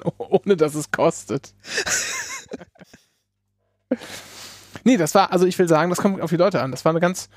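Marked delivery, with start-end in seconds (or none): 1.7-2.6 high laugh. Real, not acted. Laugh after the anecdote.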